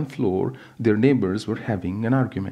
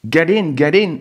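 higher, louder urgent command; shout; 7 semitones higher, 8 dB louder than usual. Acted. Cadet shouting at fleeing families, urgent shout. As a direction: call the people into the truck. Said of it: "a cadet shouted".

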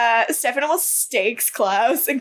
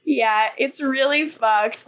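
ordinary talk

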